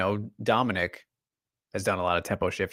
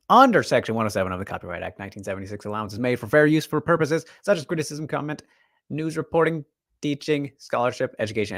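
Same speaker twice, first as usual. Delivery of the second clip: fast reading; 3 semitones higher, faster than usual. Shown as a demonstration. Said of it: "I'm gonna read it just 'cause I can".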